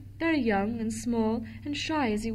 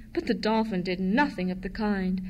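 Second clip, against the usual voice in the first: ordinary delivery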